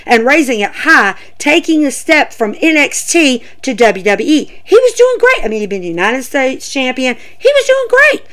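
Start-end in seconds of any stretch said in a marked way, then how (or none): 4.6-5.4 high-pitched, emphatic praise. Real, not acted. Emphatic praise of wrestler's run. 7.2-8.3 high-pitched, emphatic praise. Real, not acted.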